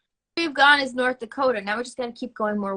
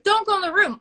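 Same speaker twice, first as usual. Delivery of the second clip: high, loud movie warning; mock warning; 8 semitones higher, 5 dB louder than usual. Viewer calls advice to the movie character, high and loud. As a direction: call it out high and firm. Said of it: "instead of horror movies".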